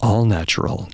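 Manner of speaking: low, slow sultry ad voice. Pretend. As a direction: purr it like a seductive ad. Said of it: "I'm gonna do this in my sultry voice".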